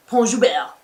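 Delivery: loud, exaggerated "Pont Joubert" with overdone last syllable; teasing mock accent. Host brother's running joke, "Pont Joubert" in exaggerated mock accent. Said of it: "he'll just be like".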